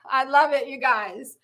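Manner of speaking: usual speaking voice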